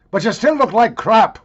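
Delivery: loud, high comic punchline; hammed-up shouted insult; mock insult yell. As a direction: holler the put-down for laughs.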